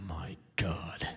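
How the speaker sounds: deep mock outrage of a cable host; Sean Hannity. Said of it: "Sean Hannity comes back like".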